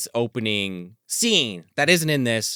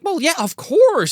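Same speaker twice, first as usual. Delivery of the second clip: high, louder, drawn-out mom impression; mock motherly reassurance; 6 dB louder, slower than usual. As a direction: play an over-assuring mother, stretched and high. Mom reassuring her creepy son.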